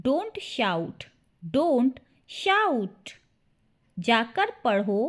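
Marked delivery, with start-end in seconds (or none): 1.6-3.1 word by word. Shown as a demonstration. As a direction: split "Don't shout" into two separate words. Word-by-word repeat for learners. ("Don't shout" रुक-रुककर, शब्द अलग-अलग)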